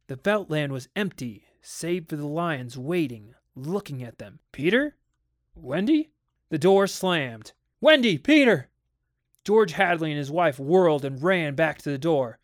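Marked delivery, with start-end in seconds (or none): 4.5-6.1 high, rising calls; questioning call. A narrator's reading. Parents searching the empty nursery, questioning call. 7.8-8.7 high, loud falling shouts; alarmed shout. A narrator's reading. Parents calling as the door slams, alarmed shout.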